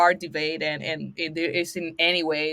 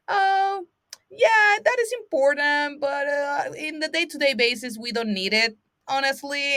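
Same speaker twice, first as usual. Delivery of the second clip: higher, airy mock voice of people brushing it off; mock-casual skeptic, pitched up; dismissive people; 8 semitones higher than usual. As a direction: play the shrugging skeptic who waves it away.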